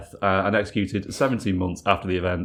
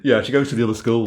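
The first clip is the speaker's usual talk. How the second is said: mock excuse; 3 semitones higher, 5 dB louder, faster than usual. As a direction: rattle off a lame excuse. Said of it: "he's like".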